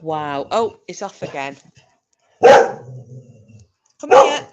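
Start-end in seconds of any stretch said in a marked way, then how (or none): 2.4-4.5 high loud call; high, loud come here to the dog. Real, not acted. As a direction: call the pet over sharply. Calling her dog.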